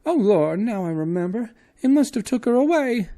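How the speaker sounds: very high, loud alarmed cry; suddenly frightened woman; high wailing maid voice. Acted.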